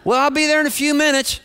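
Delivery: high, cheery sign-off. Retold or imitated. Wife promising husband she's coming soon, cheery. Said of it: "contrast between my wife's tone".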